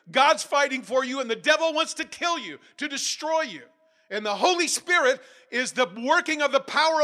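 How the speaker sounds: high, booming sermon at full force; real fervent shout, pitched high, not angry; impassioned loud preaching. Real, not acted. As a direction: raise your voice and preach it urgently. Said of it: "hard to be quiet when I".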